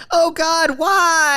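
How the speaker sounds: high shocked wail of grief; anguished cry. Acted. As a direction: cry out as a friend falls. Friend shot again.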